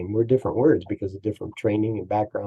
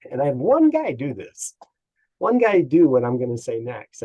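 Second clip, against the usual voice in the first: normal talking voice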